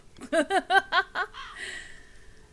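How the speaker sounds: high burst of giggling; rapid, high-pitched laugh trailing off. Real, not acted. Laughs at own accent slip.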